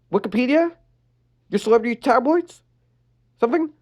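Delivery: put-on high teasing teen banter; cocky then skeptical; bandmate voices. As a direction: bandmates bickering. Bandmates arguing.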